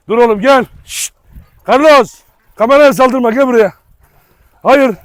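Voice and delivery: loud, high commands to a dog; sharp shouted dog commands; calling the dog. Real, not acted. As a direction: shout him off the camera.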